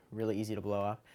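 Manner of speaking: ordinary delivery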